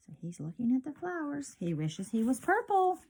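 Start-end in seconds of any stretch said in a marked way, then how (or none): none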